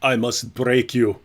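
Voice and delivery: put-on slow, low Russian accent; Russian accent, heavy and slow; Ivan Drago. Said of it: "That was Ivan Drago".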